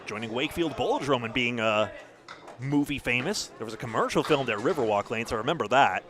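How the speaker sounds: ordinary talk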